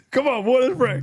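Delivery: high, laughing excitement. Real, not acted. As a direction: bubble over with laughter. Laughing joy praying with grandson.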